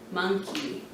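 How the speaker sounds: normal talking voice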